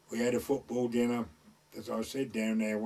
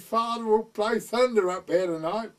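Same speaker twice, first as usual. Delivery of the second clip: quoting the daughter; 8 semitones higher, 6 dB louder than usual. Retold or imitated. Rector's daughter quoted.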